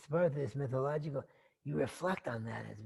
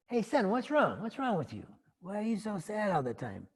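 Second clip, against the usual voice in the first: lightly acted dad, pitched up; concerned royal father; 6 semitones higher than usual. King asking his unhappy son, lightly acted fatherly concern. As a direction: question a son as a worried father. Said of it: "his dad sees that he's not happy".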